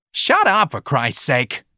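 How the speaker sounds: high, loud start, then dropping; exasperated snap. A narrator's reading. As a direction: shout him down, fed up. George snaps at screaming Ignatius.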